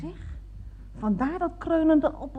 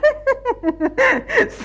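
a high nervous giggle; high embarrassed laugh; 10 semitones higher, 4 dB louder than usual. Acted. Caller laughing off her blunder, high nervous giggle.

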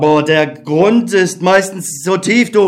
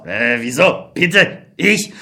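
much lower and softer, taken aback; startled colonel; 6 semitones lower, 4 dB quieter, slower than usual. Acted. Colonel startled when called a murderer. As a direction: act caught out by the accusation.

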